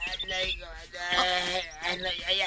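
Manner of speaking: soft, barely voiced moan of pain. Injured man moaning after fall. (naalumamay nga agasug-asug)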